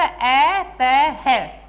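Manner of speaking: अ, त, है split into separate sounds, ending on a breathy है; spelled out. Shown as a demonstration. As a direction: break अतः into its sounds. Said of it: "उच्चारण किसका करें". (अतः को टुकड़ों में बोलें)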